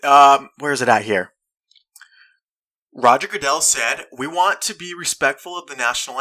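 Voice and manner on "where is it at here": normal talking voice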